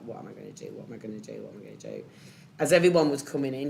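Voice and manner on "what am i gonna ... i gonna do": low, soft, repeated murmur of panic; quietly spiralling in shock, lower and softer; desperate whisper-like repeat to herself; panicked inner voice. Retold or imitated. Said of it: "I just sat at my desk and I was like".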